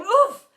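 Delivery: loud, high "oof"; knocked-down grunt of effort; impact grunt. A narrator's reading. Teacher slides to the ground for a ball, impact grunt.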